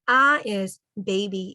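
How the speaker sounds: ordinary talk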